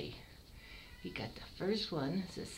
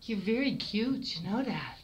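higher, proud cat mom voice; proud mother cat; 5 semitones higher, 4 dB louder than usual. Pretend. Breeder voicing the mother cat to her kitten, higher. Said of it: "Let's see how his mother's saying".

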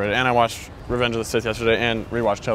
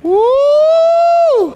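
excited woo; 8 dB louder than usual. Real, not acted. Hyped about Anakin in Fortnite.

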